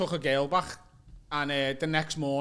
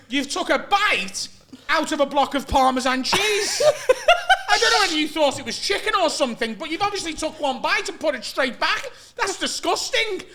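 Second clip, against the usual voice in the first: mock disgusted outrage; 10 semitones higher, 5 dB louder than usual. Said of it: "he's proper screaming at me".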